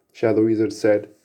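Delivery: ordinary delivery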